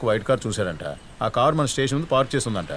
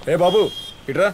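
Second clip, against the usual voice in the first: higher, louder summons; calling out; 7 semitones higher, 4 dB louder than usual. Acted. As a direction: call him over sharply and loudly. Officer calls someone over.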